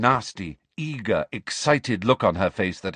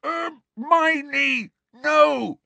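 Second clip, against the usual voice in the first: high muffled shout; shout through gag; 4 dB louder, much slower than usual. A narrator's reading. Ron stopping Hermione, shouted through his gag. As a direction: shout past a gag in alarm. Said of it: "shouted Ron through his gag".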